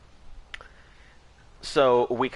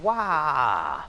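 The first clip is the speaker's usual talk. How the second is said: Waluigi cry; 6 semitones higher, 3 dB louder than usual. Acted. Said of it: "the Waluigi laugh".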